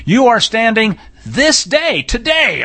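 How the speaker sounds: "this day, today" stretched out and pitched high; emphatic reading. Shown as a demonstration. Teacher reading scripture as the commentary directs, stressing "this day, today". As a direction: lean hard on "this day, today". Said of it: "I'll read it the way his, his, uh, commentary points out it ought to be read".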